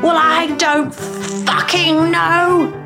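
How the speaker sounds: high, long drawn-out furious yell; acted scream without extra volume. A narrator's reading. Dan explodes at Shona's questions, screaming. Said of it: "he screamed".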